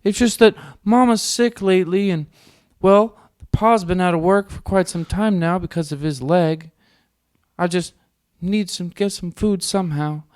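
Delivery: put-on high, slow pleading child voice; a sad orphan begging, slow and high; pitiful poor child voice. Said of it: "That's what it felt like".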